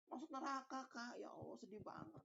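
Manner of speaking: high, soft dismayed whimper; lightly played despair of the condemned; mock lament. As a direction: plead in dismay at hell. Condemned man laments his fate.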